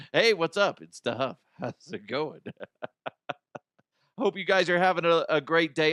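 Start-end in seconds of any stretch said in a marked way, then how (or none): none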